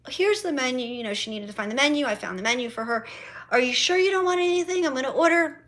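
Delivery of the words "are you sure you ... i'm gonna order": higher, quick mock mom; quoting mom. Mom offering to order food, higher hurried mock voice. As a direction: rattle it off like a pushy mother. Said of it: "mom asks again".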